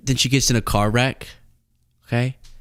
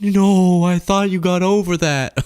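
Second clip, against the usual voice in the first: higher, louder melodramatic wail of betrayal; mock heartbroken cry; 9 semitones higher, 4 dB louder, slower than usual. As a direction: wail it like a jilted soap heroine. Acting out bedroom discovery, heartbroken partner.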